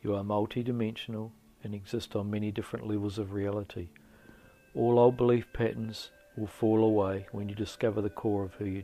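loud, measured motivational narration; affirmation read. Acted. Guide urging listeners to let go of limiting beliefs, firm affirmation. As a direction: deliver it as a firm, spaced-out affirmation. Reads affirming introduction to meditation.

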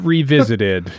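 ordinary talk, nothing marked

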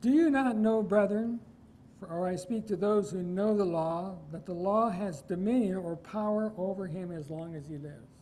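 loud, ringing pulpit read of the verse; booming Bible reading from the pulpit; forceful scripture reading. Acted. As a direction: proclaim the verse to the congregation. Reads scripture aloud forcefully.

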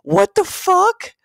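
shrieking in disbelief at the same sound effects; disbelieving shriek. Real, not acted. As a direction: screech it out in disbelief.

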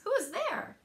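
high, startled little cry; mouse cries out. Acted. Frightened mouse calling into the dark cave. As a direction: squeak it out like a scared mouse. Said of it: "Mouse cries".

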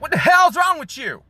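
high-pitched mock angry shout; cussing someone out. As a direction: shriek it in hammed-up outrage. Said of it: "cuss you out and be like".

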